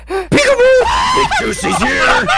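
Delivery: very high, manic screech; gleeful shout. Acted. Seeker surprises hidden boys, gleeful high shout. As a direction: burst out screeching with menacing glee.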